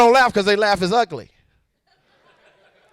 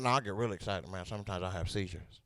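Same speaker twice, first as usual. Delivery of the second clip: very low, very soft mumble; drunk dude; 21 dB quieter than usual. Retold or imitated. Drunk man admits his condition to comic, low mumble. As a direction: confide it low and quietly. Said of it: "Drunk dude was there".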